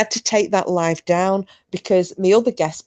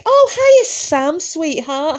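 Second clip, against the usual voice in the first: high excited greeting; 11 semitones higher, 4 dB louder, much slower than usual. Real, not acted. Greeting Sam arriving in chat.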